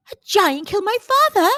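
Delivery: the news knocks the wind out of him; gasped shock. A narrator's reading. Jack learns the giant killed his father, aghast. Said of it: "gasped Jack".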